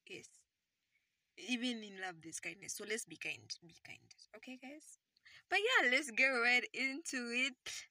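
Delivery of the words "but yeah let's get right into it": hyped-up announcement. Acted. Hyping the start.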